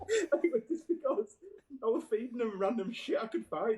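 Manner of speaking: words broken by laughter; laughing. Real, not acted. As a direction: laugh through the confession. Laughing through confession.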